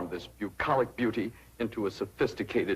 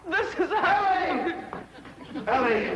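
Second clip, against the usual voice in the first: shrill announcing. Acted. Arrives in high put-on voice.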